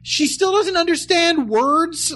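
exasperated high voice. Retold or imitated. Mocking exasperation.

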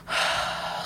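long, breathy sigh of relief; acted sigh of acknowledgement; imitated sigh. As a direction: let out a long exhale, imitating him. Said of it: "sighs and nods in agreement".

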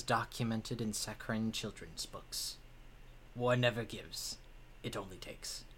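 quiet, grave, sorrowful warrior voice; somber old warlord. Acted. Warlord spirit mourns softly.

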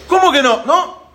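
loud, high, disbelieving challenge; indignant retort. Acted. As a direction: snap back in acted outrage. Discusión por el agua. (réplica indignada, fuerte y aguda)

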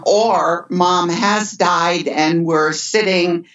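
usual speaking voice

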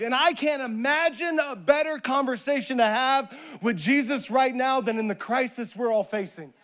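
usual speaking voice

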